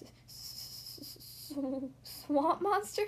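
long hissing stutter before the words, rising at the end; stammer, then squeak out the question; scared stammer. A narrator's reading. Goose gulps at the swamp monster warning, scared stammer. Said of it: "Goose gulped".

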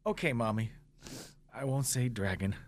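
low, soft, sheepish promise; subdued kid reply, mimicked; imitated chastened child. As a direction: mumble a reluctant promise like a kid.

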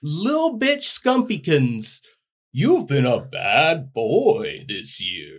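high, slow scolding Santa; drawn-out jolly Santa scold; Santa Claus voice. Acted. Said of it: "you're gonna be, uh, Santa".

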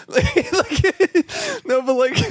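high squeaky laughing words. Real, not acted. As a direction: laugh too hard to talk. Laughing fit.

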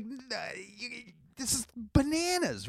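high, soft stammer; lost for words; stammering disbelief. Retold or imitated. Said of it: "So I'm like".

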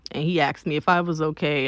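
ordinary talk, nothing marked

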